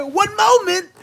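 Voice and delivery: high, loud, edgy one moment; polite words with a threat; aggressive politeness. Retold or imitated. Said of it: "and it sound like, "Dog".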